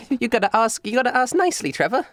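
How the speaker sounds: high-pitched coaxing; high showgirl scolding lightly; stage magician showman. Acted. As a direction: coax manners in a high voice. Magician demands politeness from Trevor.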